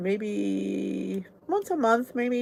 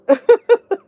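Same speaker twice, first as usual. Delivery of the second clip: loud high laugh; 13 semitones higher, 11 dB louder than usual. Real, not acted. Host cracking up at a noise mid-stream, loud high laugh.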